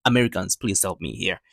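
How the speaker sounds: ordinary talk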